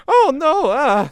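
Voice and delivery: high, loud cartoon wail of dismay; mock dismay. Creator imitating Luigi and Bowser getting made fun of, mock dismay. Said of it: "Luigi and Bowser who are like".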